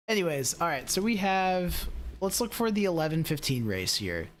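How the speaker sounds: usual speaking voice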